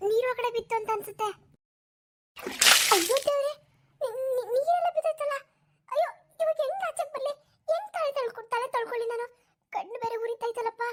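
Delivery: put-on high, fretful old woman calling for help; a flustered granny with stinging eyes, calling Jaya; old woman in the bath. (ಸ್ನಾನದ ಮನೆಯಲ್ಲಿ ಸಿಕ್ಕಿಕೊಂಡ ಮುದುಕಿ, ಎತ್ತರದ ಗಾಬರಿಯ ಧ್ವನಿ)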